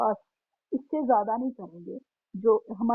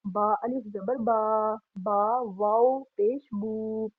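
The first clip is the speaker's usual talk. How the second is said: slow spelling out; slower than usual. Shown as a demonstration. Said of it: "यहाँ पर जो बा के आगे जेर वाला लफ्ज देखें".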